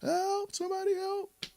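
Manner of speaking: very high, desperate falsetto plea; crying for help. Acted. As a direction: wail for help like a kid being attacked. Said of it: "you're crying in the streets".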